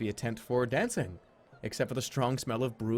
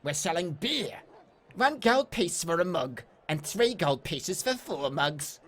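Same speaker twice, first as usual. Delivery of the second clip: higher, slow cutesy goblin voice; half-goblin barmaid; 6 semitones higher, 3 dB louder, slower than usual. Acted. Half-goblin barmaid telling the player the beer prices, higher and slow. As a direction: talk down sweetly like a goblin barkeep.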